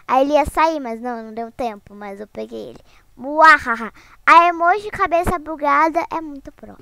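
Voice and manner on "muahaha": louder mock villain laugh; evil laugh. Streamer after catching a player, hammy evil laugh. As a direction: gloat with a hammy muahaha.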